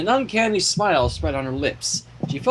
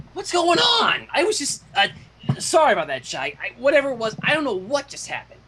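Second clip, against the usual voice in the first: startled Dash; 6 semitones higher than usual. A narrator's reading. Dash waking confused in a scene.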